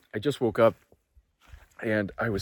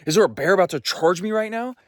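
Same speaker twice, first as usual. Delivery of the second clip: panicked; 7 semitones higher, 5 dB louder than usual. Retold or imitated. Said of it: "I'm like".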